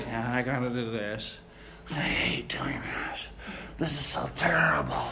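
low, soft, whiny whispered complaint; mock a grumbling worker under your breath; muttered pretend complaining.

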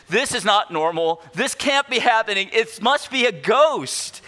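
mock panic. Disciples' panicked conclusion.

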